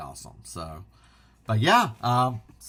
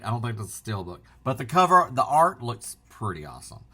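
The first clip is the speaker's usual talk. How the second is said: ordinary talk, nothing marked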